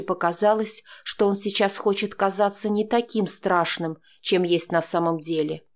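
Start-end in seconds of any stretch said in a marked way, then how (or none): none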